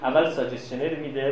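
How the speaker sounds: ordinary talk, nothing marked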